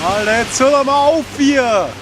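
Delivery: high, loud, fed-up yell; exasperated shout. Real, not acted. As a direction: yell at the wind to stop. Yelling at the wind.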